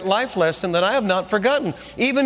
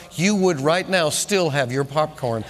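ordinary talk

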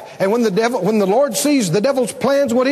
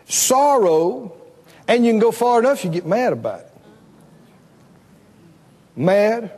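normal talking voice

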